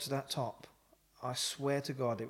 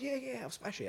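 cocky high; 11 semitones higher, 4 dB quieter than usual. Retold or imitated. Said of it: "I said".

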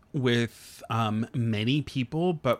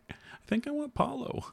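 wistful ask; 14 semitones higher, 6 dB quieter than usual. Retold or imitated. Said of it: "I did say".

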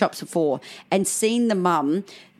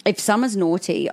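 ordinary talk, nothing marked

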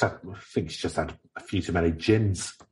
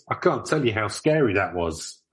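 ordinary talk

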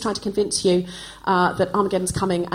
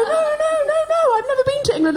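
put-on much higher, fast, posh-sounding child denying it; shrill protest; faster than usual. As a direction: protest frantically like a teased little girl. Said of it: "And I'd go".

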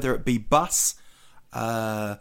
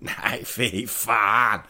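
slow, drawn-out Swedish refusal; grumpy Swede; 3 semitones lower, much slower than usual. Acted. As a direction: play a Swede refusing, stretching each word. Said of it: "I think the Swedes would be exactly the same".

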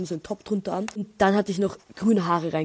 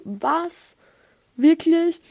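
higher, louder disbelieving "Wirklich?"; surprised; 8 semitones higher, 5 dB louder, much slower than usual. Retold or imitated. Hearing from a girl about hidden ladies' toilets, loud and surprised. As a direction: jump up in astonished disbelief. Retold reaction to toilet news.

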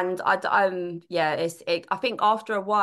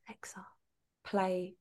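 hushed voice command to Alexa; whispering to Alexa; 11 dB quieter than usual. Real, not acted. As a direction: whisper it so Alexa won't wake. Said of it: "I have to whisper".

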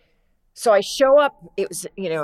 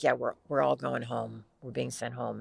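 lightly voiced low-pitched friend; a low, matter-of-fact quote of her, dropping softer; a hint of her low, cool voice; Kristen Johnson; 5 semitones lower, 6 dB quieter than usual. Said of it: "she has that low voice".

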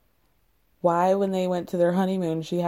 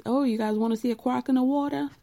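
put-on quick, lightly done Cajun accent, a bit higher; 5 semitones higher, faster than usual. Host quoting the tour guide to the couple, quick Cajun accent. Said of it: "that was actually pretty good".

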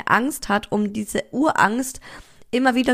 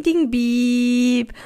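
monitor beep imitation; 3 semitones higher than usual.

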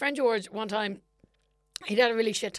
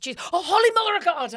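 loud, high Irish cry of mock alarm; very Irish exclamation; 6 semitones higher, 6 dB louder than usual. Imitating herself at a horror film, very Irish exclamation. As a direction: exclaim it like a startled, very Irish churchgoer. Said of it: "the most Irish I've ever seen you".

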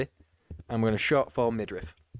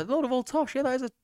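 much higher playful repeat of tosh; high mock voice; 14 semitones higher than usual. Shown as a demonstration. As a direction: pitch it way up, teasing.

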